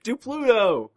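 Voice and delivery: put-on high, eager little kid demanding; mock a small child's high-pitched request. Host imitating kid asking for Pluto, high and demanding. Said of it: "he asked me to do Pluto".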